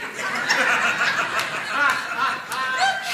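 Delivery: real high-pitched laugh; cracking up; high burst of laughter. Real, not acted. Laughing at his own story.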